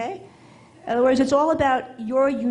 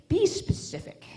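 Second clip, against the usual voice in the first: slow, high, hushed schoolteacher instruction; English teacher voice; 9 semitones higher, 4 dB quieter, slower than usual. Shown as a demonstration. English teacher coaching writers, in a slow, high teacher voice. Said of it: "in my English teacher voice".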